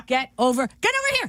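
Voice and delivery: high mock call of get over here. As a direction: shout it up high like a fed-up dad. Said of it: "you go".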